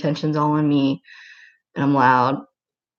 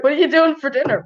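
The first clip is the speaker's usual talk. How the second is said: mock quote; 10 semitones higher than usual. Mocking the chat question.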